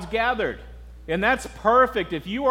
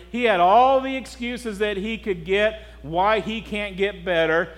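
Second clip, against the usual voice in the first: ordinary talk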